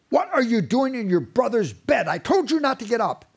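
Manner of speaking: loud, high angry scolding; scolding parent. Retold or imitated. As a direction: catch the kids in the wrong bed and scold. Parent scolds kids out of bed.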